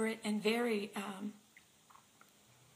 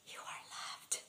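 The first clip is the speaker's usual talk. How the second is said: soft whispered reassurance, much softer; tender whisper; 9 dB quieter than usual. Retold or imitated. Holding his face in her hands, whispering softly. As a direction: breathe it gently into his face.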